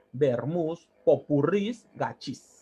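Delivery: normal talking voice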